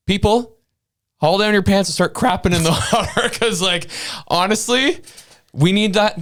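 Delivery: fired-up call to revolt, pitch way up and laughing; fired-up rallying. Real, not acted. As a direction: whip the crowd up half laughing.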